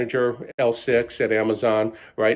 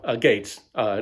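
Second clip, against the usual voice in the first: normal talking voice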